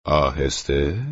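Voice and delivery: slow, drawn-out questioning repeat; sceptical, challenging question; incredulous echo. A narrator's reading. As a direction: throw the word back, stretched and rising. Neighbour echoes the excuse in disbelief.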